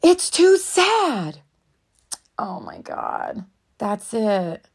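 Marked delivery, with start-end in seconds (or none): 0.0-1.4 put-on high, slow little-kid voice; little boy confessing, high and slow; small kid blurting. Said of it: "He finally blurts out".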